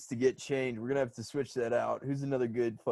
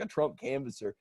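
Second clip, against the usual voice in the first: ordinary talk, nothing marked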